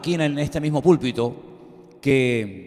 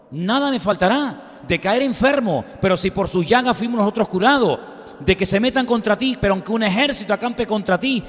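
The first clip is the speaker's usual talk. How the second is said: higher, louder preaching build; fervent preaching; 6 semitones higher, 3 dB louder than usual. Acted. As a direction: press the congregation with rising conviction. Preaching at full force.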